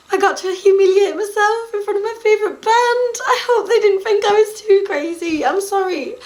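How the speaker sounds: blurting it out through real tears; real crying. Real, not acted. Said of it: "I'm crying again".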